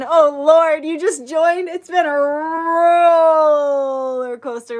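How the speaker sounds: high, loud, drawn-out mock despair; exaggerated exasperation. Real, not acted. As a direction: pile on the drama, it's been wild. Viewer just joined mid-stream.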